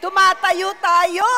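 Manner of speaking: loud, high, slowed rallying call; shouted call. Real, not acted. Preacher calling the church to stand, shouting. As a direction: pull everyone up onto their feet.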